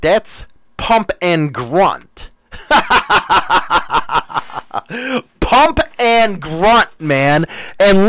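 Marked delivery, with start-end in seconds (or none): 2.5-5.3 long staccato belly laugh. Real, not acted. Host reading chat's pump and grunt, real long laugh. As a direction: laugh in a run of quick pulses.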